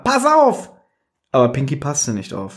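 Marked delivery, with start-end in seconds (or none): 0.0-0.7 high-pitched, urgent warning; warning cry. A narrator's reading. Peter warns Pinky as she leaps out, high urgent cry. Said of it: "rief Peter".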